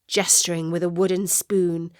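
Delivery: ordinary delivery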